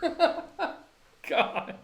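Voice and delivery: high laughing "God". Real, not acted. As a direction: laugh it out, half appalled. Reacts to own dark joke.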